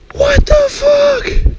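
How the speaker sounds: very high, slow squeal of disbelief; shocked squeal. Real, not acted. Shock after fish surprises him.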